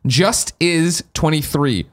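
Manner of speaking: slowly spelling out the name; drawn-out name read. Shown as a demonstration. Host reading a listener's name out slowly. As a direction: read the name slow and deliberate. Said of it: "The way it sounds".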